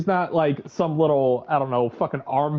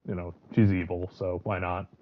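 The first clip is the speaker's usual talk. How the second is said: normal talking voice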